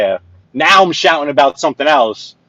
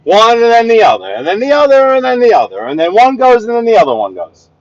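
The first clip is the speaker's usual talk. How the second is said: exasperated yelling; 8 semitones higher, 3 dB louder than usual. Real, not acted. Exasperated at drivers taking turns.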